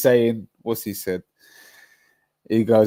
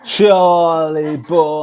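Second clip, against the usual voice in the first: drawn-out greeting; 6 semitones higher, 10 dB louder, much slower than usual. Real, not acted. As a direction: stretch it out like hailing a mate. Calling out to a friend, drawn out.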